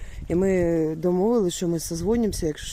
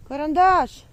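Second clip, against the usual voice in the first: calling the dog; 8 semitones higher than usual. Real, not acted. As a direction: call your dog from far away. Calling her lost dog. (голосно кличе собаку)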